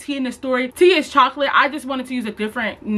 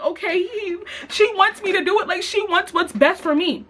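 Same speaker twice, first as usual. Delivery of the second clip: naive; 7 semitones higher than usual. Retold or imitated. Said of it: "I'm my naive ass".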